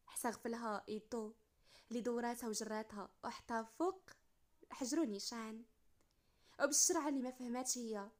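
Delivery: usual speaking voice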